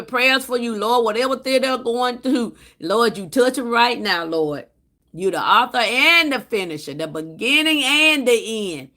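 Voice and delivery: praying. Acted. Spontaneous prayer for viewer.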